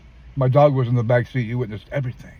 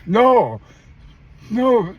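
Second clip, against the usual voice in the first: tearful mother; 11 semitones higher, 7 dB louder than usual. Retold or imitated. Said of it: "She started crying".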